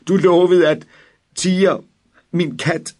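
high, loud, broken cry; distraught wail. A narrator's reading. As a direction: cry out desperate for his cat. Boy distraught over cat. (fortvivlet, høj og grådkvalt)

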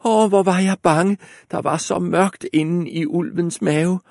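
high loud relieved cry; girl crying out. A narrator's reading. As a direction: burst out frightened and relieved. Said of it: "råbte".

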